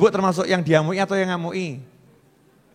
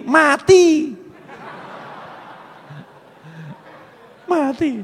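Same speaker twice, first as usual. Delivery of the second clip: emphatic punchline; 9 semitones higher, much slower than usual. Acted. Preacher's punchline.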